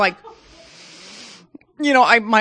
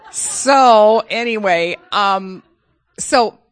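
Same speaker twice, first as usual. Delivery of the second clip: slow, loud laugh through the words; 3 semitones higher, 7 dB louder, much slower than usual. Real, not acted. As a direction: laugh it off and carry on. Laughing while resuming.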